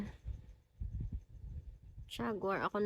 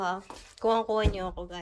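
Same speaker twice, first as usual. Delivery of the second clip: ordinary talk, nothing marked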